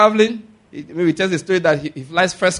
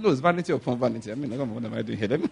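amused retelling; 7 semitones lower, 8 dB quieter than usual. Retold or imitated. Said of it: "I said".

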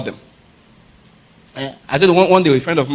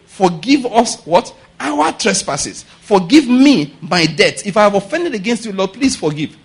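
slow, raised-pitch supplication; pleading prayer; 4 semitones higher than usual. Acted. David asking God's forgiveness, pleading prayer. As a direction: plead it out, phrase by phrase. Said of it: "what he was supposed to do was pray".

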